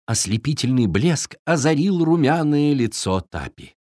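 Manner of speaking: ordinary delivery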